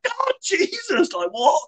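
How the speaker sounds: high, slow shocked cry. Retold or imitated. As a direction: gasp it out in disbelief. Shocked reaction retold.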